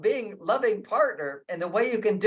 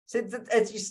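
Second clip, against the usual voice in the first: ordinary delivery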